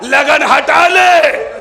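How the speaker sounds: very slow, high, loud plea; urgent call. Acted. Imam Hasan calls to Farwa, slow urgent cry. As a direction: stretch the words out in a cry.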